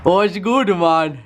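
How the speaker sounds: high, sing-song greeting; mock echo greeting. Echoing greeting higher.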